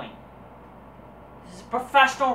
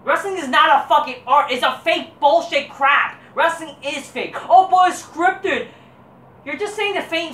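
heated rant; 6 semitones higher, 6 dB louder than usual. Real, not acted. Ranting against wrestling fans.

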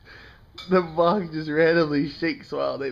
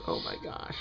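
breathless whispered awe; hushed amazed whisper; 14 dB quieter than usual. Real, not acted.

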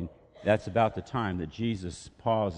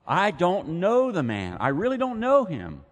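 desperate denial; 9 semitones higher, 6 dB louder than usual. Retold or imitated. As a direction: plead your ignorance hard. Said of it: "Peter's repeated denial".

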